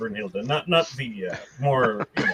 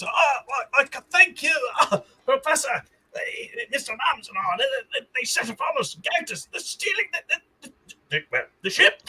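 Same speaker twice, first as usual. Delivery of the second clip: high, rushed, panicked captive; frantic rescued prisoner; 11 semitones higher than usual. Acted. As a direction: babble your thanks in a panic. GM voices freed prisoner.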